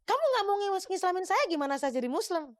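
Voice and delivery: high, insistent retold plea; acted protest. Would-be convert pleading with imam who refuses him, high-pitched protest.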